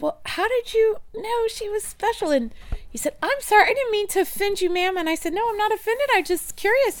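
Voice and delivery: high, quick retold back-and-forth; high mock dialogue. Mother and shop guy misunderstanding, voiced high and lively. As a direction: pitch it up and play both sides lightly.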